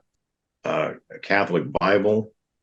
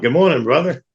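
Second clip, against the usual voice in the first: much higher and louder, big smile in the voice; beaming greeting; 9 semitones higher, 4 dB louder than usual. Retold or imitated. Smiling churchgoer greets him at the sign of peace, beaming. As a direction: greet a stranger like a long-lost brother. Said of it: "he's like".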